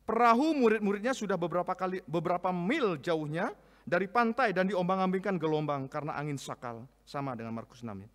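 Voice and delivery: loud, high, fervent Bible reading; scripture read with force. Acted. Pastor reading the storm passage aloud, fervent. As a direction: proclaim the passage to the congregation.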